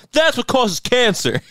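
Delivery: high, slow, hammed-up warning; over-the-top imitation of dad; mock alarmist dad. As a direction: exaggerate a parent's dire pronouncement. Mocking dad's exaggerated health warnings.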